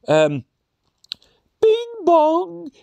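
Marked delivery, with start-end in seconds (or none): 1.6-2.7 sung two-note bing bong chime. Pretend. Doing the station chime before an announcement.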